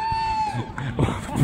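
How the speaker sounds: very high delighted laugh; high squeal of laughter. Real, not acted. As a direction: squeal with joy. Laugh after third goal.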